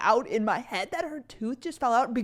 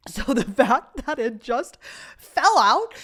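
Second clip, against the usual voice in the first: laughing; 3 semitones higher, 8 dB louder than usual. Real, not acted. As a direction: laugh through the words. Laughing retelling of tooth.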